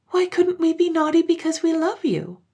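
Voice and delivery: put-on high-pitched child voice; high, pleading childish question. A narrator's reading. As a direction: ask plaintively like a little girl. Children plaintively question their mother.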